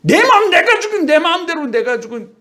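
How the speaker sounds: put-on high childish whine with a laugh; child's high defiant whine, laughing; whiny child. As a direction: squeal it like a stubborn four-year-old. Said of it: "그런데 이 자식이".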